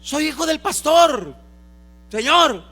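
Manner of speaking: loud, high pleading cry; desperate plea. Acted. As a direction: beg the Lord at full voice, high and loud. Hijos suplicando al Señor. (súplica desesperada a gritos)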